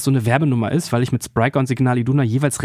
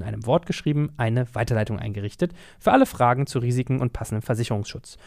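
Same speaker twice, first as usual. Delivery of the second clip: softer, steady sponsor read; 3 dB quieter than usual. Acted. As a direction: read the ad copy smoothly. Host reads sponsor ad.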